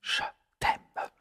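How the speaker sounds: whispered softly. Real, not acted. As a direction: breathe it like a secret. Whispered goodbye to the viewers.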